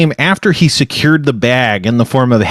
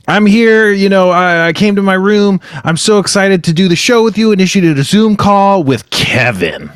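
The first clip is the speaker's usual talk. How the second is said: higher, animated retake; put-on excitement; 6 semitones higher than usual. Host redoing the intro for his co-host, eagerly excited. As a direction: sound so excited to be here. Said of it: "I could take that again".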